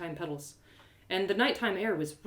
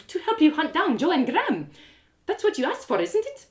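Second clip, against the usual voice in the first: Hatha's voice; 9 semitones higher, 5 dB louder than usual. A narrator's reading. Hatha's voice in narration.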